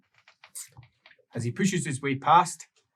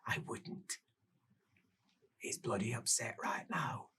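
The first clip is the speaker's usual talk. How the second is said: hushed, breathless warning; whisper; 14 dB quieter than usual. Acted. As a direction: whisper it like a creepy secret. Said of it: "before she says, whisper".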